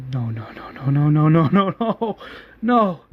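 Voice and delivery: denial building into anguish; despairing no's. Acted. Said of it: "Carl's like".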